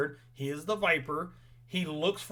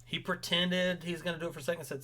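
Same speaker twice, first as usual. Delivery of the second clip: ordinary talk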